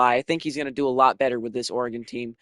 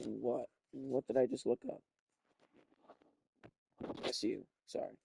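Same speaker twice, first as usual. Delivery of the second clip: whispered aside; 3 semitones lower, 13 dB quieter than usual. Real, not acted. Muttered correction to self.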